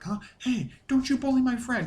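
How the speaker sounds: high, loud protective outburst; indignant Vice. Acted. Fan acting out Vice defending Icky, loud indignant squawk. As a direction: shout up high, defending a pal. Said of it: "Vice is like".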